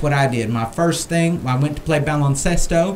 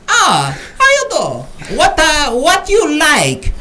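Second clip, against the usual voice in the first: high, slow mock Chinese accent, drawn out; a hammed-up Chinese accent imitation; 11 semitones higher, 4 dB louder, slower than usual. Bartender greeting the customer in mock Chinese accent. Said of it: "this Chinese bartender says to me".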